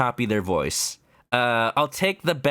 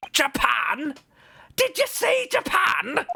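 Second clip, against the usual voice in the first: much higher, slow, shouted disbelief; shocked shout; 13 semitones higher, slower than usual. Acted. Governor shouting in shock that the lawyer is from Japan. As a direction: blow up in outraged surprise.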